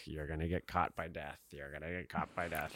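low, hushed chant of doom; ominous. Retold or imitated. Host voices a song's doomy association, ominous and hushed. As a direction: drop low and soft, like a creeping warning. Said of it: "with this, like, ominous, like".

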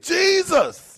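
mama's high-pitched yell, retold; imitated yell. Son retelling mama watching the game, high mock yell. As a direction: mimic mama crying out, high. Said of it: "my mama yelled and said".

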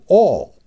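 "all" drawn out as a capitalized word; stress on ALL. Retold or imitated. Host reading the former president's statement, heavy stress on "all". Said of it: "all caps".

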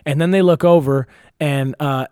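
ordinary talk, nothing marked

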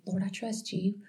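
softly imitated little prayer; quiet quick prayer. Looking up at work to tell the Lord, softly. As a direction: drop to a soft, private aside to God.

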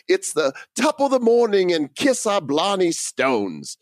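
high, hammed-up Irish accent; bad Irish accent. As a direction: overdo a stage Irish accent. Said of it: "toss in a few bad Irish accents".

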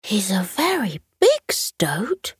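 slow whispered little stoat; whispering child. Acted. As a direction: hush it like a shy child. Young stoat speaks.

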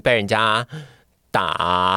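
usual speaking voice

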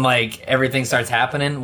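ordinary talk, nothing marked

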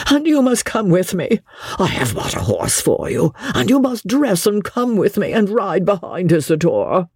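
high, urgent pleading; passionate appeal. A narrator's reading. Frightened brother urging Amelia to ride away, passionate appeal. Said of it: "made a passionate appeal to Amelia".

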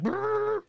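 high, trembling brrr; high shiver brrr. Retold or imitated. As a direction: shudder out a nervous brrr. Shiver sound of panic.

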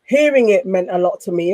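ordinary talk

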